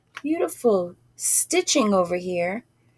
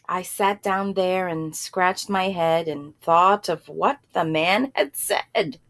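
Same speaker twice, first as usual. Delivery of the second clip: usual speaking voice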